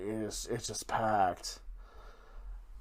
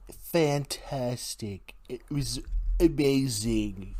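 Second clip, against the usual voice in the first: hammed-up politician impression, slow drawn-out words; Donald Trump; slower than usual. Podcaster jokily praising the town in a horrible Trump impression. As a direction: stretch each word slowly in a mock presidential voice. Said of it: "I'm doing a really horrible Donald Trump impression".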